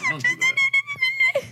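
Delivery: very high, slow, squeaky Muppet meep; Beaker voice. Retold or imitated. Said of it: "You sound like Beaker".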